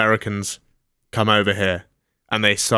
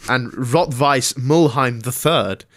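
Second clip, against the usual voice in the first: raised, projected sports-segment read; news read; 4 semitones higher than usual. Acted. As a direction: present the headline like a sports newsreader. Presents the sports news item.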